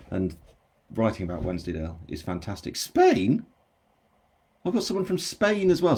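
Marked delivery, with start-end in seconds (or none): 2.8-6.0 "Spain?" jumps up high and loud in surprise; delighted disbelief at a far-off viewer; surprised. Real, not acted. Surprised to see a Spanish viewer.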